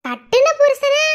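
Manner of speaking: கட்டின hit high and loud; mock-repeating, punching கட்டின; stress on கட்டின. Wife mocks grandma's words, leaning on கட்டின.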